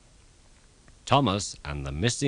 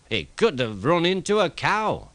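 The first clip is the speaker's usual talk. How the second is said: high, raised engine voice; Donald; 8 semitones higher than usual. A narrator's reading. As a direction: toss in a silly guess. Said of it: "suggested Donald".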